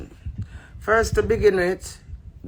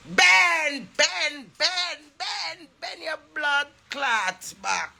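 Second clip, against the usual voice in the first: repeated higher calls of Ben, ending in a slow shout; hollering Ben's name to get his attention; calling out; 9 semitones higher, slower than usual. Real, not acted. Calling out to Ben across the room: high repeated calls.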